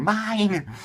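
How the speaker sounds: high, loud, stretched possessive cry; mad hyper fanboy. Acted. Book lover grabbing every American edition, hyper fanboy cry. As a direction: claim it all like an insane fan. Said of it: "Like some mad, insane, very hyper fanboy".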